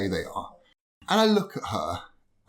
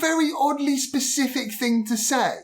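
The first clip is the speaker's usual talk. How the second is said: incredulous; 4 dB louder than usual. Retold or imitated. Retelling his own reply to demon.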